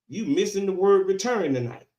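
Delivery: ordinary talk, nothing marked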